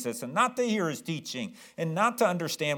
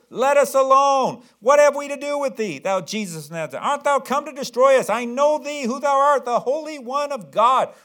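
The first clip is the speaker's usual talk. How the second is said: high, loud shrieking demon voice; demon screaming; 10 semitones higher, 8 dB louder than usual. Acted. Possessed man screaming at Jesus in the synagogue, shrieking. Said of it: "screams at the top of his lungs".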